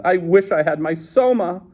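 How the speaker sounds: high, plaintive cry; distressed wishing. A narrator's reading. Woman behind her hands craving Soma, high lament.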